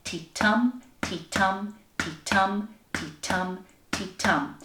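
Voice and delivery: "ti-tum" as separate even pulses, TUM stressed; five steady "ti-tum" beats, last one falling; heartbeat rhythm. Shown as a demonstration. Teacher modelling a chant to say together, rhythmic. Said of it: "We're gonna go".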